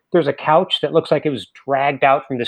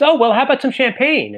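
eager host; 10 semitones higher, 3 dB louder than usual. Retold or imitated. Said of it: "He says".

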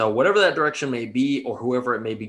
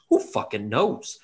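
normal talking voice

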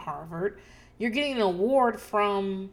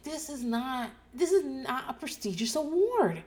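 exasperated lecture; 7 semitones higher than usual. Retold or imitated. Podcaster lecturing Danny about the award, exasperated and raised. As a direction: scold him like a friend who won't listen. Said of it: "And I'm like".

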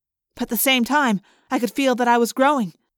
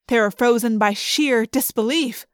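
plain reading voice

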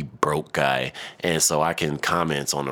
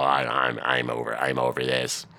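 mocking her; 6 semitones lower than usual. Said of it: "shut the fuck up talking about".

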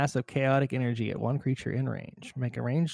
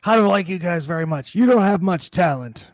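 louder, higher, put-on voice of offence; indignant character; 4 semitones higher, 5 dB louder than usual. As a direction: play an affronted villain telling them off. Game master acts out hurt Simon.

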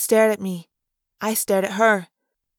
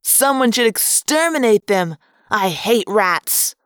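mildly raised shout, slowing at the end. A narrator's reading. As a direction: call it out as a hostile jerk, drawing out the last words. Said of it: "another voice shouted".

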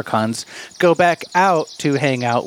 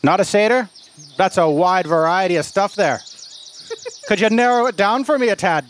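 lilting mock Irish, pitched up; exasperated Irish leprechaun; 7 semitones higher than usual. Leprechaun explaining limits of his spell, in mock Irish. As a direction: play a put-upon leprechaun in fake Irish. Game master plays leprechaun.

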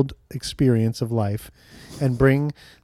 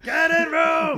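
Animal Muppet; much slower than usual. Pretend. Repeating the Animal Muppet shout.

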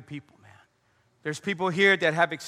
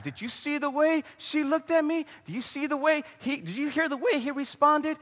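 much higher, indignant whiny inner voice; offended gossip voice; 9 semitones higher than usual. Pretend. Mocking how we stew over slights, whiny indignation. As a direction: play the easily offended complainer.

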